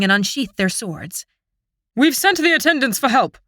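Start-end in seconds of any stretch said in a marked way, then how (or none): 1.9-3.4 high, urgent yell. A narrator's reading. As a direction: call it out over the battle. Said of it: "the Sylph captain yells".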